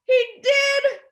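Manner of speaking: high, wide-eyed exclamation; mock astonishment. A narrator's reading. Teacher to children about buried kitty, high astonished cry. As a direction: confirm it as shocking news.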